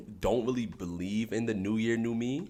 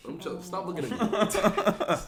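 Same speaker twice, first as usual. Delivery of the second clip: flustered protest; 12 semitones higher, 3 dB louder than usual. Real, not acted. Teary host begging co-host not to look, high and flustered. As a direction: whine to be left unwatched.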